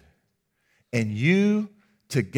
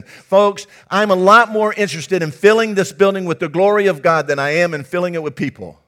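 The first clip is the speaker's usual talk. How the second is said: fiery preaching; 3 semitones higher, 6 dB louder than usual. Acted. Pastor urging the congregation.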